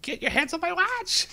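high, strangled shriek imitating the seller; freaked-out yell. Watch seller yelling at a customer who touched it, freaked-out screech. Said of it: "and freaks out".

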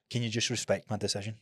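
quiet guilt trip. Shown as a demonstration. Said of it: "make them feel worse".

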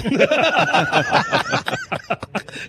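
real high laugh; high burst of laughter. Real, not acted. Laughing about messing with Buddy over the lawsuit.

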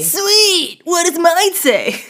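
high, slow dopey dude voice; stoner dude voice. Acted. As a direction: drawl it like a dim movie stoner. Film quote call and response.

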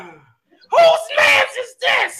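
extremely high, stretched-out holler; catchphrase shout. Shown as a demonstration. Cash trying to match the catchphrase, piercing long shout. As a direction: scream it long and loud, imitating the catchphrase. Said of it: "you wanna give it a shot".

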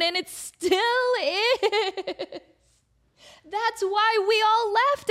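high, slow, laughing whine; mock-whiny protest through laughs. Whining reply to friend.

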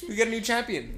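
high, loud thrilled news; excited announcement. Real, not acted.

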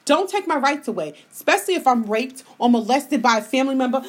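ordinary talk

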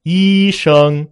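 high, loud, level first tones; high key. Shown as a demonstration. Teacher showing relative pitch, word in a high key. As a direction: hold both syllables high and level. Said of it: "比如说我说".

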